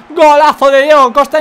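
loud excited goal praise; excited commentary. Real, not acted. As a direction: hype up the goal. Celebrating the goal.

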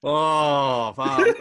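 loud, overwhelmed oh fuck; awed outburst. Real, not acted. Recalling the Kratos trailer with friend, loud awed outburst. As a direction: blurt it out, struck with awe. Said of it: "I'm getting goosebumps thinking about it now".

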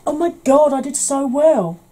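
high, loud and thrilled; elated self-congratulation. Real, not acted. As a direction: crow over your own success. Celebrating doing well at something.

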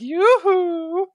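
high, drawn-out come-hither call; mock coy hail. Murderer flagging down a car with his pant leg up, mock flirty. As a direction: sing out a teasing hail in falsetto. Imitating a murderer luring a driver.

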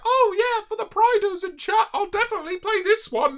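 high put-on eager voice; mock virtue signalling. Streamer imitating himself pandering to chat, mock high-pitched eagerness. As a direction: pitch it way up, mockingly agreeable. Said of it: "and be like".

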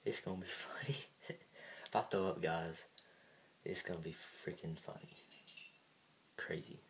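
giggly hushed whisper. Real, not acted. Whispering to camera.

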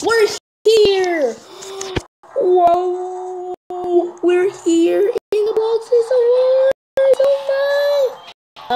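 high squeal of arrival; very excited. Real, not acted. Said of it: "I'm so getting real excited".

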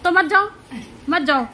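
loud, huffy dismissal; cross retort. Real, not acted. Wife retorting to husband about going along, loudly annoyed.

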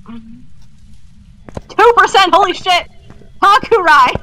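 high startled outburst; alarmed yelp at teammate's sanity; excited shock. Real, not acted. Sanity crashes to two percent.

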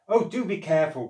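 loud, quick, worried cry; a fast alarmed plea over the hubbub; cried out. Acted. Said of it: "cried Elf".

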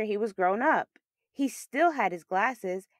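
ordinary delivery

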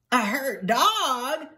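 high, slow, incredulous beagle; astonished question. Acted. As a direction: stretch it out in surprise. Clerk astonished by the news.

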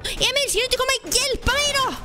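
high, fast, indignant plea; urgent cry for help. Real, not acted. Player demands help from teammate, urgent high cry. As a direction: call your teammate over, pitched high and hurried.

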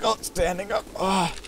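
high, strained mock groaning; mock effortful getting-up. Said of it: "Takes me a while to recover".

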